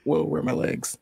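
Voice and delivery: breathless, whispered snake voice; a soft, airy snake in shock. Acted. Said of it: "'Cause he's like".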